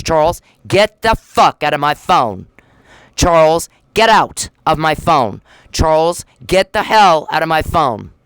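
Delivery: loud, slow angry demand; furious shout. Real, not acted. Ordering Charles out of her phone, furious shout. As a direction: yell him out of your phone.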